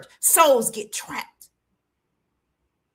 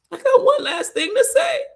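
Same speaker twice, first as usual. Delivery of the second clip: very high, breaking wail; crying desperately; 13 semitones higher, 4 dB louder than usual. Retold or imitated. Daughter begging her daddy to hear her, high desperate crying. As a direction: wail it desperately through tears. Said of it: "And I'm crying".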